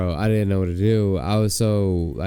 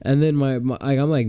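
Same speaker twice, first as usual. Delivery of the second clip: usual speaking voice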